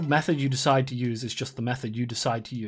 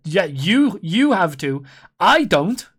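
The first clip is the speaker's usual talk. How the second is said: loud stress on "you" and "I"; emphatic contrast; 2 semitones higher, 7 dB louder, much slower than usual. Acted. Mock insisting on being exempt.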